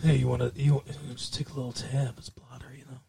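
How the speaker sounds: low, fading-away sly offer; hushed voice on the shoulder; drug pusher. Acted. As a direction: tempt a kid under your breath. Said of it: "He could be sitting on a kid's shoulder".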